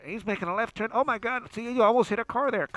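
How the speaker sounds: retelling a TV announcer calling a car chase; excited announcer. Retold or imitated. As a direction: ham up the TV commentator. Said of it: "you could hear the announcer".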